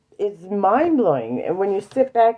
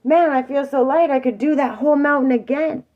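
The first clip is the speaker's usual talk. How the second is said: elated relief; 6 semitones higher, 3 dB louder than usual. Retold or imitated. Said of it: "on the bottom going".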